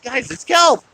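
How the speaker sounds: high-pitched retold plea; mock exasperation. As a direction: call out as if reasoning with silly animals. Acts out own plea to sea lions.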